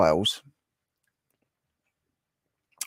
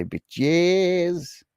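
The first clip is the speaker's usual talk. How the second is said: playful jab; 8 semitones higher than usual. Pretend.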